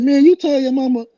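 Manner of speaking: high disbelieving accusation; incredulous. Retold or imitated. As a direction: call him out, amazed. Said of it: "I said".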